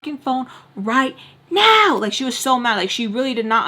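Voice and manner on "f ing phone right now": angry mom again. Retold or imitated. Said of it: "She's like".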